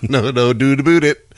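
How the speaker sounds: hammed-up Canadian, "doot aboot"; Canadian accent. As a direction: overdo the Canadian vowels in "doubt about". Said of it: "that was Canadian".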